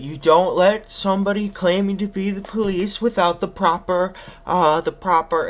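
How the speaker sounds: high, emphatic warning that stalls; groping for a word. Retold or imitated. Fake cop lecturing the woman, groping for a word. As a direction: lecture her, then stumble hunting for the word. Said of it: "can't even remember the word for badge".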